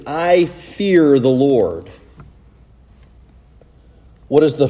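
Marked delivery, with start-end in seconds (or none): 0.1-1.9 very slow, each word weighed; reverent, slow declaration; slow solemn fear. Retold or imitated. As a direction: draw out I fear the Lord. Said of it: "what he's actually saying is".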